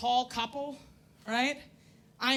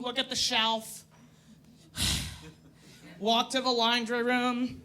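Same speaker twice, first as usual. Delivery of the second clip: usual speaking voice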